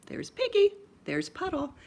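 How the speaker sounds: put-on bright reading-to-kids pointing voice; squeaky piggy intro. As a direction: sing out the piggy's name up high. Reading picture book to kids.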